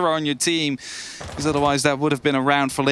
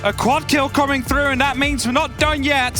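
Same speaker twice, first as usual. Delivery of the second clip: esports caster; 11 semitones higher than usual. Acted. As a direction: keep the hype going. Co-caster continues hype.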